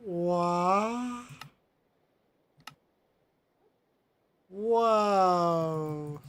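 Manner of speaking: amazed whoa. Real, not acted. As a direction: blurt it out, stunned and thrilled. Amazed by something on screen.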